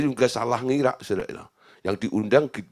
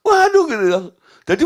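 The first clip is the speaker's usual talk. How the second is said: ordinary talk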